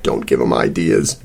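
low nervous croak. A narrator's reading. Sal as the dog is offered a snack, croaking. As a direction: croak it out, pitched well down. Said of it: "Sal croaked".

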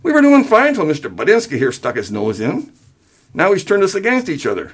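high-pitched, indignant complaint; righteous indignation. A narrator's reading. Father blames son to his wife, righteously indignant. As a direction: accuse the meddler with indignation. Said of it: "filled with righteous indignation".

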